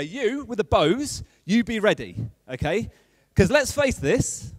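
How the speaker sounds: rally the troops, building in pitch and force; rousing victory speech. Acted. Nehemiah posts his people on the wall, rallying speech. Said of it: "the little victory speech".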